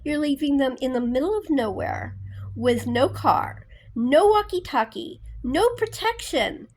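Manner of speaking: going off angry. Retold or imitated. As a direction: tear into the boss like he did. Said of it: "he was just going off".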